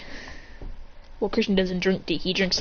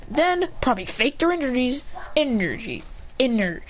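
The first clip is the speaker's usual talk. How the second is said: usual speaking voice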